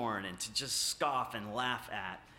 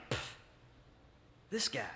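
scornful scoff; 5 dB quieter than usual. Retold or imitated. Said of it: "can you see the attitude".

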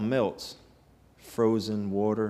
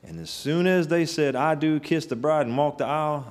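higher, louder preacher's build; fervent preaching; 5 semitones higher, 3 dB louder than usual. Acted. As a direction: climb through the wedding moments. Preaching climax.

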